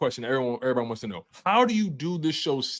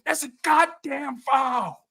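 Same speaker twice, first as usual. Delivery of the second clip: high, drawn-out mock heckle; bleacher yell; 11 semitones higher, much slower than usual. Dad in the bleachers, losing his mind, bleacher yell. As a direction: stretch it out like a sports parent protesting. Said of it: "I'll be in the bleachers".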